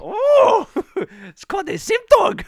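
high, loud hammed-up German accent; horrible German accent. German lab scientist showing Gunther his creation, hammed-up German accent. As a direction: call out as an excited mock-German scientist. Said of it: "That's a horrible German accent".

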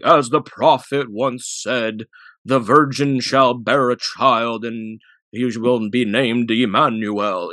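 slow, grand scripture reading; solemn prophet voice. Pretend. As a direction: intone it like a prophecy. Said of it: "you can't even say it normally".

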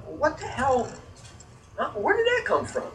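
retold startled confusion; baffled. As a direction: wonder aloud at a strange noise. Retold reaction to a thrown rock.

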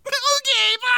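very high, chirpy quick goodbye; mock squeaky goodbye. Retelling the movie, Jenna brushes Matt off in a squeak. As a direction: toss it off in a squeak.

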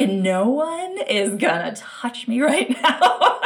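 high, slow and laughing defiance; defiant. Retold or imitated. Mother in labour refusing to be moved, laughing defiance. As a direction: declare it with a laugh, stubborn. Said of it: "You're like a birth rebel".

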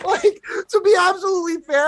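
high, laugh-choked words. Real, not acted. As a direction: squeeze it out while still laughing. Laughing at own verdict.

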